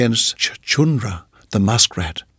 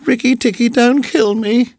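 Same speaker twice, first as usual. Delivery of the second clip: almost weeping; 12 semitones higher than usual. A narrator's reading. Muskrat begging Rikki Tikki for his life, almost weeping. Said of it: "almost weeping".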